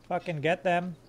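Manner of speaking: ordinary talk, nothing marked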